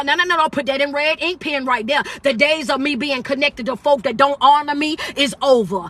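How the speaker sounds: normal talking voice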